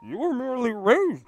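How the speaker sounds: high whiny villain voice, abandoned; a high, whiny villain line; Bane impression. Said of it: "I got this".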